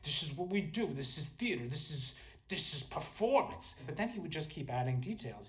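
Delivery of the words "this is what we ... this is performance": imitated strict theater director, intent on the performance; strict friend. Friend ordering actors to stay in character, mock strict. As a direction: lay down the rules as the strict friend. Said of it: "my friend was very strict".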